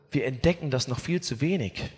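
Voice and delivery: ordinary talk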